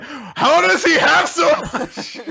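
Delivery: high, slow, laughing dismay. Retold or imitated. Poor girl seeing his pile of chocolates, laughing dismay. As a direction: gasp it out while laughing in disbelief.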